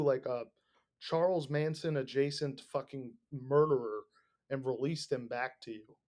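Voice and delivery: ordinary talk, nothing marked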